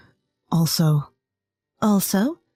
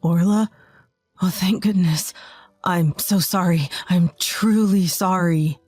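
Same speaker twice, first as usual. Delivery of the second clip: tearful husband; 2 semitones lower than usual. A narrator's reading. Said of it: "tears streaming down his face".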